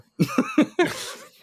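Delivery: airy, high laugh; breathy laugh. Real, not acted. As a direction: laugh at your own joke. Laughs at own joke.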